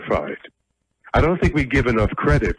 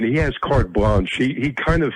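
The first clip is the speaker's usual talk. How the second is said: normal talking voice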